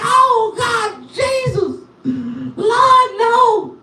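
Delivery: high, sung lament; anguished wail. Retold or imitated. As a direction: cry out to God in comic grief. Watching car crush teeth.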